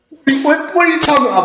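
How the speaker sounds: high, incredulous protest; baffled disbelief. Retold or imitated. Cashier baffled by accusation.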